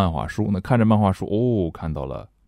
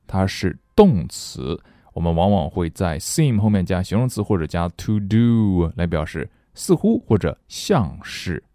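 ordinary talk, nothing marked

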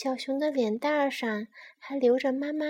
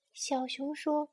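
usual speaking voice

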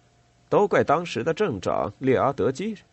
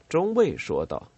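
usual speaking voice

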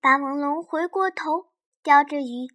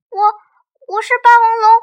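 thin voice; 10 semitones higher, 13 dB louder, much faster than usual. Acted. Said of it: "那你的声音怎么那么细".

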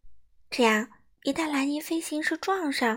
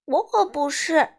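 slow, higher, louder protest; 5 semitones higher, 5 dB louder than usual. A narrator's reading. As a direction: object firmly, drawing the words out. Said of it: "莱尼抗议道".

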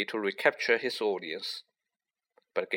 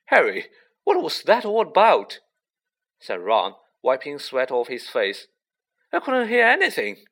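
higher, louder, out of breath; breathless alarm; 3 semitones higher, 5 dB louder than usual. A narrator's reading. Ron, panting after the chase, questions Harry. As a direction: gasp out the questions.

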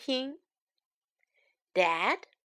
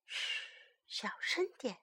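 very soft whispered hush; 16 dB quieter than usual. Acted. Storyteller warns listeners not to wake baby, hushed whisper. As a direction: whisper it so nobody wakes.

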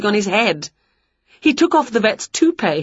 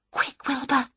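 urgent hushed call to the cat; whispered; 6 semitones higher, 8 dB quieter than usual. A narrator's reading. Witch hurrying her cat away, hushed. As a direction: hurry him quietly, voice pulled way down. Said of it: "she whispered".

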